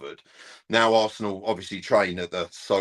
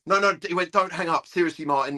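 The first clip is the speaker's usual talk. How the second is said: higher, urgent plea not to hang up; urgent caller; 8 semitones higher than usual. Retold or imitated. Caller begging Martin not to hang up, higher. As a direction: beg him to stay on the line.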